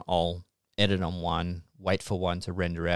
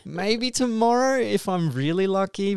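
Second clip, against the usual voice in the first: hopeful high; 11 semitones higher than usual. Real, not acted. Hopeful about new PC.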